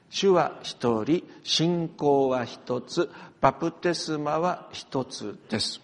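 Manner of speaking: very slow, measured Bible reading; solemn slow scripture recital with weight on 一つ. Acted. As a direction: read each phrase slowly and solemnly, with long pauses. Reads scripture aloud.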